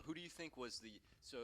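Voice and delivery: usual speaking voice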